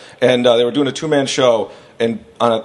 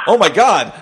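high, giddy amazement; freaking out; 7 semitones higher, 4 dB louder than usual. Retold or imitated. Young comic offered his first big break, freaking out. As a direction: blurt it out, overwhelmed with excitement.

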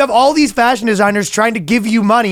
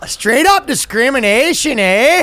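mock Canadian; 6 semitones higher, slower than usual. Continuing Canadian bit.

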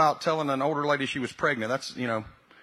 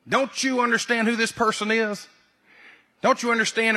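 mock fussing; 6 semitones higher than usual. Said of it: "We want to go fuss at him".

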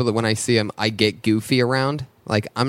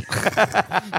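high burst of laughter; cracking up at his own bit; laughing; 9 semitones higher than usual. Real, not acted.

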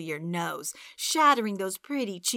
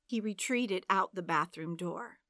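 plain reading voice

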